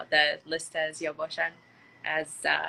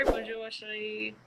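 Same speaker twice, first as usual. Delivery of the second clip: slow, softer attempt at a French accent; 2 semitones higher, 5 dB quieter, much slower than usual. Shown as a demonstration. Streamer answers someone speaking French, attempted French accent. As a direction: put on an amateur French accent, drawn out. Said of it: "اکسنت فرانسویم خوب نیست".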